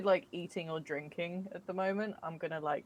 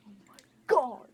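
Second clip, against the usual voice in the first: whispered start bursting into a high exclamation; breathless, thrilled disbelief; real awed delight; 4 semitones higher, 5 dB louder than usual. Real, not acted. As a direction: gasp it out, overwhelmed. Player still overwhelmed by red panda.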